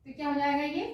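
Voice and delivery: ordinary delivery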